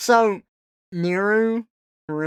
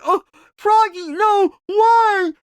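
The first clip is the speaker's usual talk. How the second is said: high, loud hammed-up grief; mock anguished wail; 7 dB louder, slower than usual. Friend mourning Froggy's ashes, in a high, loud mock wail. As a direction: wail for Froggy like it's a tragedy. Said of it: "And he's like".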